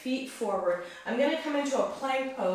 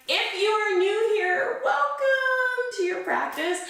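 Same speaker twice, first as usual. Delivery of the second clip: higher, slow, sing-song greeting; warm welcome; 11 semitones higher, 5 dB louder than usual. Real, not acted. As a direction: draw out the welcome warmly. Welcoming new viewers.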